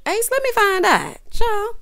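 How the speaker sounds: loud, high exclamation of disbelief; mock outrage. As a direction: cry out in playful, scandalized outrage.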